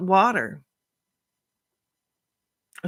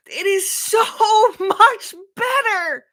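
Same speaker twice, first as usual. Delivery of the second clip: crying; 12 semitones higher, 5 dB louder than usual. Real, not acted. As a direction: voice breaking high into tears, much higher. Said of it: "I'm just always crying".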